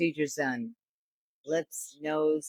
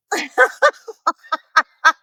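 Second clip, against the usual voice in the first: loud, high cackling laughter; real laughing fit; 11 semitones higher, 10 dB louder than usual. Real, not acted. Comedian cannot get through his joke, laughing hard. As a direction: lose it laughing. Cracks up telling own joke.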